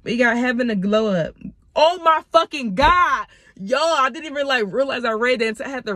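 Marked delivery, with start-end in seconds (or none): 1.8-3.2 loud and higher, stretched slowly; stunned outburst. Real, not acted. Reader stunned by the card she drew, slow loud outburst. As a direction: let the realization burst out. Realizing what she just read.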